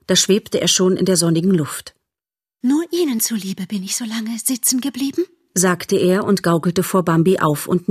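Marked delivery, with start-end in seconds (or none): 2.6-5.2 breathy, whispered and lofty; airy whisper. Acted. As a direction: float the line away like a vain butterfly. Butterfly airy parting. (luftig geflüstert)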